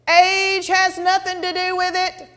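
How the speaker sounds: high, sustained, slow declaration; pastor's proclamation. Retold or imitated. Pastor standing before her, ringing slow proclamation. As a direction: proclaim it like a word from God. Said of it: "this is what he says".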